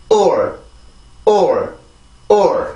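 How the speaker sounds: or as a stressed, falling oor with final r; rhythm drill. Shown as a demonstration. Teacher drilling the rhythm before adding T, rhythm drill. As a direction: say or as one falling beat. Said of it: "다시 한번".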